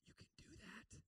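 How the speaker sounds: whispered "You can do that?" in disbelief; incredulous whisper. Retold or imitated. Seventh grader whispers in shock at his praying friend. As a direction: breathe it out, stunned. Stunned boy at prayer circle.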